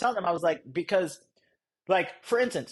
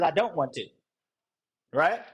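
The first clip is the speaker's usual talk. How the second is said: normal talking voice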